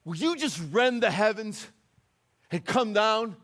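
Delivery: high, loud prophet's cry to heaven; frustrated plea. Acted. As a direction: cry out in exasperation, punching each phrase. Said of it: "he starts to get frustrated".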